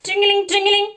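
high, loud bell imitation; ringing bicycle bell. A narrator's reading. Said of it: "says his bicycle".

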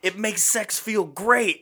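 high, fast, laughing drug-dealer voice; Tim Meadows impression. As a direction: hype it up like a sleazy dealer. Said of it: "Tim Meadows is the best part".

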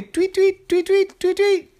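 very high, loud chirped words; bird tweeting. Acted. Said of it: "they all started tweeting".

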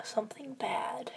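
slow secret whisper. Real, not acted. Whispering during ASMR game.

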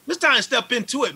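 rally the listener into action; amped. Real, not acted. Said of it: "I know I'm amped about this stuff".

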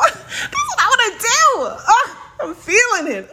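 high laughing words breaking through. Real, not acted. Vlogger cracking herself up talking to viewers, laughing. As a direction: giggle the words out, real amusement.

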